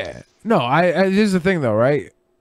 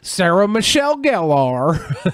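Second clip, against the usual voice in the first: slow Southern simpleton drawl; Forrest Gump; slower than usual. Shown as a demonstration. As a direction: stretch the name out slowly and earnestly. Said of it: "I say it like Forrest Gump".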